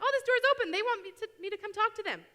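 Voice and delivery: high, loud, sing-song mock voice; hammed-up high voice of a passer-by. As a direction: squeak it out like a naive visitor. Mocking a visitor's thought.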